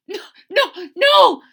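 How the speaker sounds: rising to a loud, high gasp. Acted. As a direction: gasp out the no's. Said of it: "gasped the rhinoceros".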